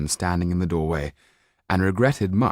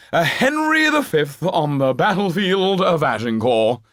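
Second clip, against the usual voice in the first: much higher, louder, slow grand declaiming; theatrical old actor; 9 semitones higher, 4 dB louder, slower than usual. Acted. Actor praising the brave boy to the banker, theatrical declaiming. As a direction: play it to the back row.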